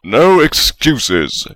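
slow, loud bellow. A narrator's reading. Godric silencing Salazar's excuse, loud slow bellow. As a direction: bellow him down. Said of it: "Godric bellowed".

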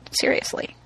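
unvoiced whisper of seriously; whispered aside. Real, not acted. Mom ashamed of cussing on the podcast, whispered. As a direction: breathe it as an aside. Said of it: "whispered aside".

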